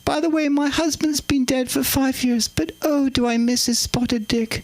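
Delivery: high regal confiding aside; high-pitched monarch impression; the Queen. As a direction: reminisce in a high royal voice. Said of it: "Okay, Queen, get on with it".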